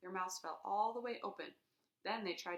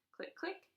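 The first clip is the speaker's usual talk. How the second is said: whispered clicking; camera clicks. Acted. Narrator imitating mother's camera. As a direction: click like a shutter.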